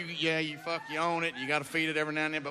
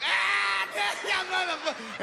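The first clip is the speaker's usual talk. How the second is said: laughing hard; 12 semitones higher, slower than usual. Retold or imitated. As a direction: crack up so hard the words barely get out. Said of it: "laughing as hard as I've ever laughed".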